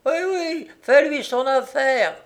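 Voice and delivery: high-pitched woman egging him on; shrill woman. A narrator's reading. Woman in the house urging the man on, shrill. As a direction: goad him on in a high, sour voice. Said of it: "la voix de femme répète".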